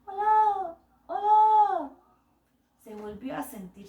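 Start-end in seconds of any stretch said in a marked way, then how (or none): 0.1-1.9 higher, short falling echo of the call. Acted. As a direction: answer back as the distant echo. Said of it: "que era el eco".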